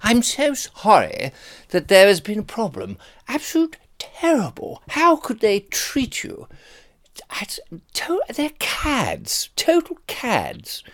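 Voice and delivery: exaggerated old-school English toff accent, very high; hammed-up English cad voice, high and drawn out; high-pitched, outraged English gent accent; Terry Thomas. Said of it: "typical English Terry Thomas accent".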